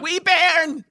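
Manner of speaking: high, laughing Scottish "Wee bairn"; relishing the word "bairn" in a playful Scottish accent; mock Scottish. Savours the word "bairn" in a laughing Scottish voice. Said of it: "I just love the word bairn".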